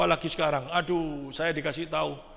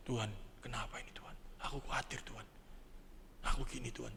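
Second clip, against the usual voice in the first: hushed, low whisper to God; tearful prayer, retold; 7 semitones lower, 16 dB quieter than usual. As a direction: whisper it like a private, troubled prayer. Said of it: "Saya nangis". (doa lirih berbisik, menirukan tangis)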